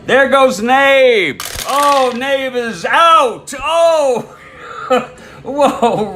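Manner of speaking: loud, high, drawn-out call; excited play-by-play. Real, not acted. Announcer calling Nabe out stealing, thrilled shout. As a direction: cry out the failed steal with astonishment.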